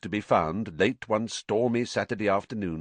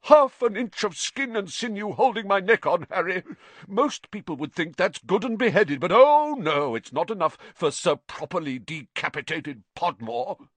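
higher, louder bitter outrage; fuming indignation; 10 semitones higher, 5 dB louder than usual. A narrator's reading. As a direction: spit out the injustice. Nick fuming over letter.

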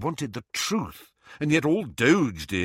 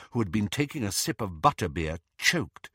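ordinary delivery, nothing marked

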